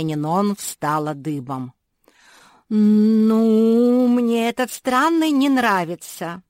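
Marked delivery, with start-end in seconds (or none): 2.7-6.4 high, loud, drawn-out grumble; uneasy complaint. Acted. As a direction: draw out the opening word, loud and high. Balking at the strange creature.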